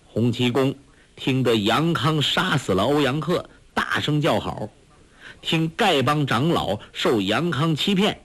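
ordinary delivery

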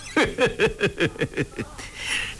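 long, menacing "hei hei" laugh; cold sneering chuckle. A narrator's reading. Commander closes in on his captive, laughing coldly. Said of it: "嘿嘿冷笑".

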